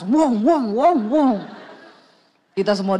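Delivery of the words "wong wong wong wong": repeated rising-falling "wong" revs; imitated engine revs. Husband revs up for the ramp, imitated engine noise. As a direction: mimic revving before a climb. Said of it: "Suami ancang-ancang gas".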